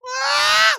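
very high, loud imitated baby scream; piercing scream. Baby Mabel freaking out, imitated scream. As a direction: shriek like a startled baby. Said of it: "like, screams".

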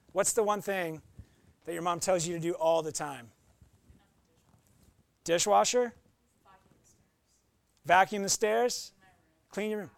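ordinary talk, nothing marked

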